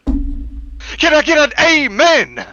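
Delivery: preacher call. Acted.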